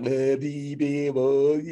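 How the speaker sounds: tongue-tied nonsense babbling; flustered babble. Retold or imitated. Stunned by the chief's invitation, flustered babble. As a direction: sputter meaningless syllables in panic. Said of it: "I was having seizures".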